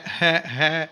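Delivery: normal talking voice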